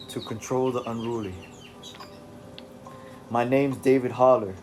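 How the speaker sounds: put-on loose British accent for David; attempted British accent. David telling the reader about his mind, in a rough British accent. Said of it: "this will have to do".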